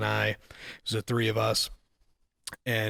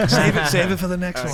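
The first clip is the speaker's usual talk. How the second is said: laughing; 7 semitones higher, 8 dB louder than usual. Real, not acted. Laughing wrap-up.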